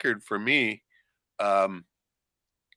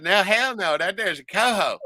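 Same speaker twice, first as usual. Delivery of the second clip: a lighter mock Southern accent on the retold answer; 6 semitones higher than usual. Fisherman retelling his Southern-accented reply to the tourist. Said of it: "grabbed his accent just immediately and threw it right back at him".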